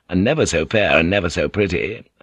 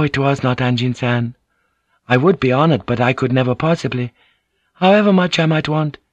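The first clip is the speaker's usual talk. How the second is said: high-pitched lady protesting politely; Mariko, earnestly denying; 7 semitones higher than usual. Acted. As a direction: raise the register and insist it was someone else. Mariko denies it was her.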